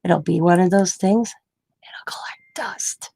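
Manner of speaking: wife whispering the gadget will gather dust; whispered prediction. Real, not acted. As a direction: hush it like a secret.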